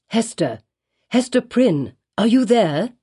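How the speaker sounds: plain reading voice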